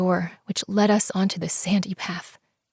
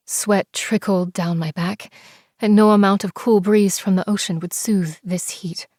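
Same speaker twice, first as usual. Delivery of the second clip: ordinary delivery, nothing marked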